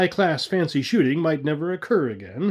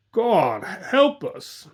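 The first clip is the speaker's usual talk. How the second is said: bellowed; 5 semitones higher, much slower than usual. A narrator's reading. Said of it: "he bellowed".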